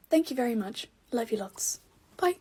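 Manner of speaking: ordinary talk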